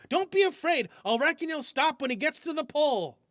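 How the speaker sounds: calm him down from afar; mild shout. Acted. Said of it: "shouted F".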